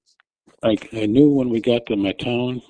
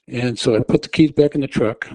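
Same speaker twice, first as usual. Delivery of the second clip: ordinary talk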